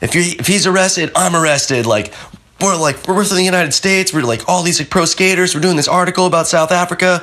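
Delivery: high, fast defiant threat; high, fast defiant rant. Retold or imitated. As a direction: rattle off threats to the cop. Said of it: "he was just like".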